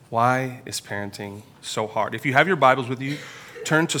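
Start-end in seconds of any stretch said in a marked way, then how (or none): 0.1-2.1 "Why is parenting so hard?" slow and a touch softer, "why" drawn out; slow reflective repeat. Real, not acted. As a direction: let the repeat sink in slowly. Repeats the question slower, softer.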